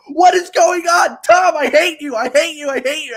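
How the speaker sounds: loud panicked yelling at Tom; mock freakout. Guest retelling his backstage meltdown, yelling at the host. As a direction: yell it like you're losing it backstage. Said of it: "seeing me flipping out in backstage".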